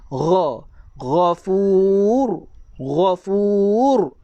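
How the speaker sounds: "ghafur" with gh from the upper throat, long final vowel; correct ghain. Shown as a demonstration. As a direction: slowly model "ghafur", holding the u. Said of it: "pastikan tempat tuan-tuan sebut ini sama".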